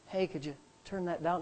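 soft, low murmur; very quiet voice. Shown as a demonstration. Preacher speaking under blaring music, very quiet voice. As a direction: say it too softly to carry. Unheard request in a loud room.

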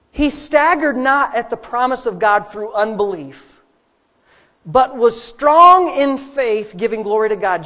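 slow, rising, booming scripture read; preaching scripture. Acted. Pastor proclaiming the verse to the church, booming. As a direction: build the verse to a loud peak.

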